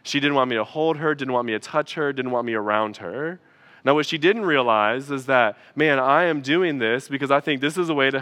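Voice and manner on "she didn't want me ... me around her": ordinary talk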